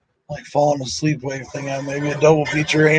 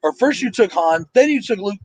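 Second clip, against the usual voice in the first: mock outrage, pitch climbing much higher to the end; indignant complaint rising higher and higher; retold angry protest; 8 semitones higher than usual. Fan retelling his outrage at the film, pitch climbing higher. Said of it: "I got mad".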